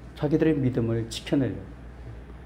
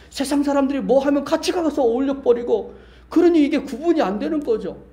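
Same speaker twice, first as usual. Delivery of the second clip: high, insistent sermon rebuke; impassioned preaching; 10 semitones higher, 3 dB louder than usual. Real, not acted. Preacher rebukes believers who blend into the world, impassioned. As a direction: drive each repeated charge home with raised pitch.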